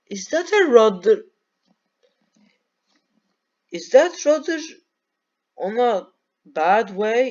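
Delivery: surprised. Real, not acted. Spotting a stuck rudder.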